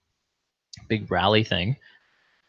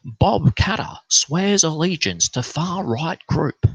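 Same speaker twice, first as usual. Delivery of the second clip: mock headline; 7 semitones higher, slower than usual. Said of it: "this newspaper article's like".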